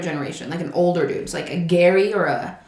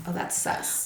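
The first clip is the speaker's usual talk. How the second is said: breathy, near-whispered "that's sus"; 13 dB quieter than usual. Shown as a demonstration. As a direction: say "sus" under your breath. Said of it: "what is sus".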